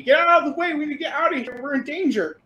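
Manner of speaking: high-pitched panicked yell; urgent yelling. Acted. Fleeing engineers to the hero in their way, urgent yelling. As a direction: yell a warning at someone blocking the road. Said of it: "they're yelling at you".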